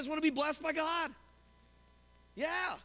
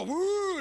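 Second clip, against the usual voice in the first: mock woo; 7 semitones higher, 7 dB louder than usual. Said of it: "And you go".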